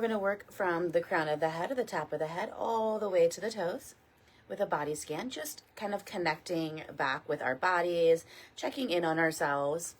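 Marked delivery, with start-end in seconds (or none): none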